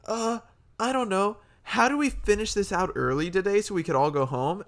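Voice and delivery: high-pitched mock slacker classmate; put-on high whiny student voice; mocking lazy groupmates. Mimicking lazy film-class groupmates.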